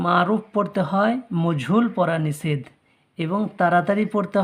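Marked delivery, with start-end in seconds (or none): none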